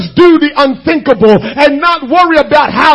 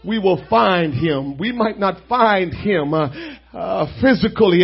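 much softer, lower murmured prayer; 6 semitones lower, 8 dB quieter than usual. Acted. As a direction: pray quietly under the music. Soft prayer over music.

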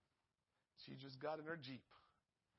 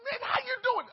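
excited greeting; 11 dB louder than usual. Retold or imitated. Greeting the governor.

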